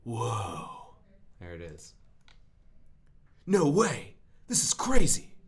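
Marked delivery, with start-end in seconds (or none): 0.0-1.0 stretched-out, awed movie "whoa"; Keanu Reeves. Acted. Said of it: "do my best Keanu Reeves impression". 3.5-5.3 loud, high movie-star exclamation; Keanu Reeves. Acted. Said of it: "do my best Keanu Reeves impression".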